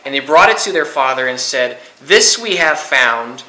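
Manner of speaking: measured, solemn Bible reading; solemn scripture reading to a youth group. Acted. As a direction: read the verses aloud with weight, slowing on the quote. Reads scripture aloud.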